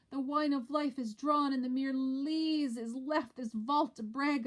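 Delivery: lightly acted grief. Macbeth feigning grief before the court. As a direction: lament the loss. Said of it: "Macbeth has to play the part also".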